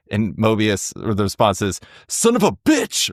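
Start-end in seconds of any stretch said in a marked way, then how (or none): 2.1-3.1 much higher, slow, hissed curse. Acted. Reading Mobius's reaction aloud to a friend, high slow hissed curse. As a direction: spit the curse out slowly, pitched way up. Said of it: "Mobius hissed".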